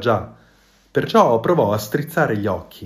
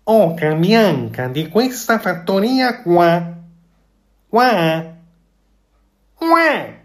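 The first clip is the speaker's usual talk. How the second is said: louder, high goose greeting, last qua leaping up; more convinced goose; 8 semitones higher, 6 dB louder, slower than usual. A narrator's reading. The goose repeats her greeting to please the fox, louder and surer. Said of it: "ripeté con più convinzione".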